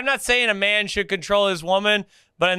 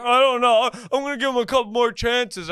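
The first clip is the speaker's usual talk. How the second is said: nervous dodge; 7 semitones higher than usual. Retold or imitated. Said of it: "He's like".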